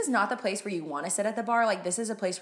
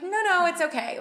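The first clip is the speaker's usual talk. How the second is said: much higher, a bit louder, flustered polite no; awkward refusal; 9 semitones higher, 4 dB louder than usual. Retold or imitated. Turning down a drink from a date who can't drink, flustered. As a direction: brush it off fast, uncomfortable.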